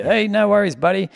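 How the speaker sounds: passer-by greeting. Retold or imitated. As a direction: call out a friendly hi. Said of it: "so many people walking past you".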